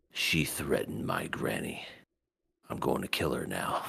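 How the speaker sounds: quiet, low and menacing; low menacing threat. Retold or imitated. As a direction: growl the threat under your breath. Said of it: "Momo was pissed".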